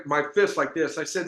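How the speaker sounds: ordinary delivery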